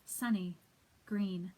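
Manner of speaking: spaced-out, deliberate verse reading; poem recitation. Acted. Reader sharing a comforting last poem, deliberate recitation. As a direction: set each short phrase apart with a pause.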